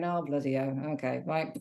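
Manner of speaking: low, soft, deflated "oh bloody hell"; resigned sigh. Retold or imitated. To herself in a prep crisis, low and soft. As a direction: mutter it low like giving up. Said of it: "by me having these moments going".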